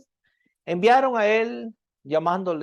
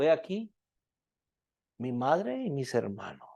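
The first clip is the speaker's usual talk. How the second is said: soft scripture reading; 6 semitones lower, 7 dB quieter than usual. Acted. Preacher reads Jesus' words to the congregation, softly. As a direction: lower and softer, slowing on the words of Jesus. Reads a Bible passage aloud.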